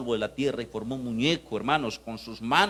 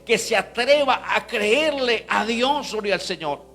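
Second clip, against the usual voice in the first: loud, high, driving sermon voice; fervent pulpit declaration; full-force preaching; 8 semitones higher, 7 dB louder than usual. Acted. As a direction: proclaim it to rouse the whole church.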